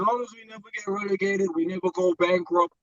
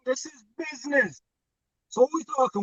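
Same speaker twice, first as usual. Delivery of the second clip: normal talking voice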